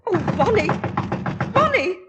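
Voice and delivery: frightened calling. Acted. Mother calling her son through a locked door, rising panic. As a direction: call through the locked door in growing alarm.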